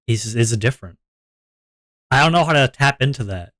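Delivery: normal talking voice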